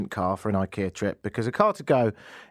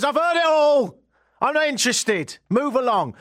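high, loud mock policeman brushing him off; dismissive cop; 13 semitones higher, 4 dB louder than usual. Policeman cutting off the driver, loud and dismissive. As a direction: bark it like a bored cop.

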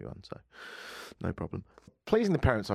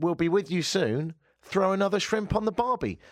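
higher-pitched mock Aussie accent; mock Australian accent; 10 semitones higher than usual. Border guard teasing a UK traveller, mock Australian accent. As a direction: imitate a guard putting on an Australian twang. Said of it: "in a mock Australian accent".

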